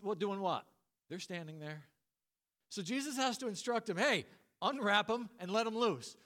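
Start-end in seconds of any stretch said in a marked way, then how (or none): 3.9-6.2 higher, louder acted command; calling out orders. Jesus to frozen onlookers at the tomb, raised commanding call. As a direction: rouse stunned bystanders with a raised call.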